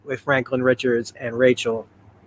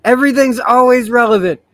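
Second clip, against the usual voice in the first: mock lament; 8 semitones higher, 4 dB louder than usual. Real, not acted. Mock lament about old election echoing today.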